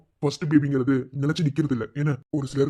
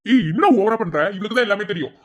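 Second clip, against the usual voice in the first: loud, raised-pitch retort; indignant; 6 semitones higher, 6 dB louder than usual. Acted. Friend tells off classmate for overdoing it, loud and indignant. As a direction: snap back indignantly, high and loud. Friends quarrel over project team.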